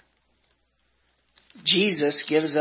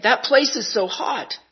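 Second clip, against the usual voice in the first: begging; 7 semitones higher than usual. Acted. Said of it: "I begged".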